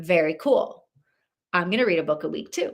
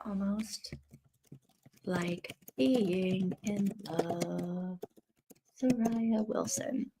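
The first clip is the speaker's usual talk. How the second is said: reading the title very slowly and softly; slow, soft reading off the cover; 9 dB quieter, much slower than usual. Shown as a demonstration. Checking the book cover for the title, read very slowly and softly. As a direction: sound it out word by word, softly, as you read it off the cover. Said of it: "let me look at the cover".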